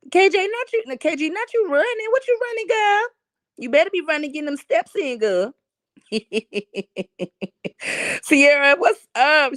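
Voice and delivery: high, playful calling out; excited greeting. Real, not acted. Teasing a viewer who is out running, high and playful. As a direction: tease the viewer, high and bright.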